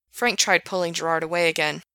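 usual speaking voice

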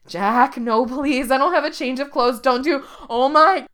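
loud, high desperate begging; loud high panic; panicked pleading. A narrator's reading. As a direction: plead loudly as you are thrown. Begging before being thrown.

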